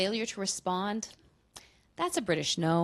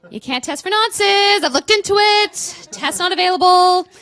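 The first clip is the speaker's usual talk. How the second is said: loud punchline; 9 semitones higher, 10 dB louder than usual. Acted. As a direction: belt it out like a proclamation.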